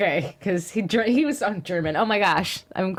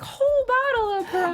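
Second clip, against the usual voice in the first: put-on kid's bright idea; 11 semitones higher than usual. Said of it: "I was like".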